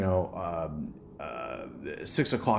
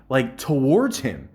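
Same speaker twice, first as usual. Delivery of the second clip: usual speaking voice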